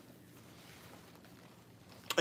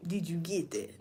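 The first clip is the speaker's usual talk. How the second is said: soft whisper mimicking the rival; whispered catchphrase; 3 semitones lower, 10 dB quieter than usual. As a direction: breathe it in a whisper. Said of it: "why it gotta sound like the way I said it".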